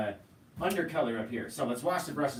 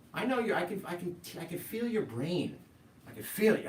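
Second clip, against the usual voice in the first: mock mind-reader; 7 semitones higher than usual. Host playing mind-reader to viewers.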